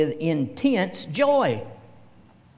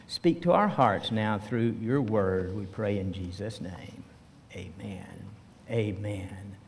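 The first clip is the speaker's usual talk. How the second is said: low, hushed praying voice; prayer; 7 semitones lower, 5 dB quieter than usual. Real, not acted. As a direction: pray softly and reverently, pitched well down. Leads opening prayer.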